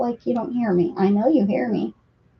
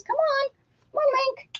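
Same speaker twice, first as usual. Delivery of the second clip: way up high coaxing call; high pet call; 3 dB quieter than usual. Real, not acted. Owner coaxing Link with a very high call. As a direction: sing it up high to the cat.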